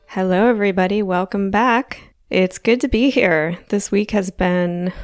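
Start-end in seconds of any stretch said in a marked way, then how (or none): none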